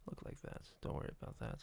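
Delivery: breathy secretive whisper; whispered aside. Real, not acted. Joking aside about his new look.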